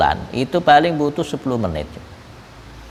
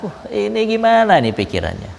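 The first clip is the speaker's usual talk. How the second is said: puzzled wonder; 8 semitones higher than usual. Retold or imitated.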